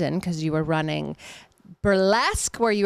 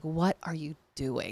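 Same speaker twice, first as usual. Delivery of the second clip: slow, soft, lower-pitched disbelief; baffled onlookers; 3 semitones lower, 8 dB quieter, slower than usual. Retold or imitated. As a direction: question it slowly, as if baffled. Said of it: "people would look at me and be like".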